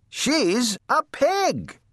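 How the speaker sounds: very high, drawn-out indignant cry; indignant shout. A narrator's reading. Eugenia sets her sister straight about the pig, indignant shout. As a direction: insist slowly, pitched right up. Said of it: "shouted Eugenia".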